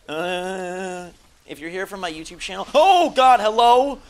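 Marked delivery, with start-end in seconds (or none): 2.7-4.0 loud high oh God hello; real startled yell. Real, not acted. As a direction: jump in fright mid-sentence. Startled mid-greeting.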